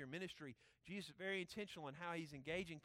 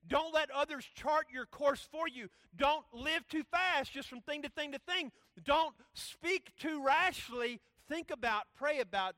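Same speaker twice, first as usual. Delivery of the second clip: loud, higher, slow punched commands; preaching at full force; 8 semitones higher, 11 dB louder, slower than usual. Acted. Preacher listing rules for intentional living, full-force preaching. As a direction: hammer each command out slowly and loudly.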